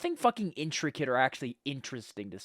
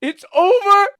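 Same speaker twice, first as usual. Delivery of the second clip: high, loud cry of despair; hammed-up heartbroken howl; mock wail; 15 dB louder, slower than usual. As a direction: wail that all is lost. Acted break-up wail.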